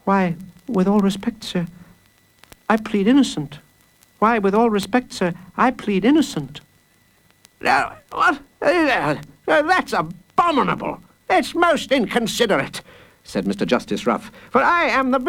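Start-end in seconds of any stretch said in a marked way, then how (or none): 0.0-6.6 put-on high, careful child's voice; earnest little boy. A narrator's reading. Small boy pleading innocent to the judge, polite and earnest. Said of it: "Taking a deep breath". 7.6-12.8 high, sputtering indignation; outraged judge. Acted. Judge offended by a plea of innocence, sputtering.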